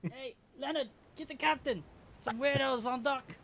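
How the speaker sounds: high, loud call across deck; acted alarmed sailor's shout; calling a shipmate. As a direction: holler for help, pitch way up.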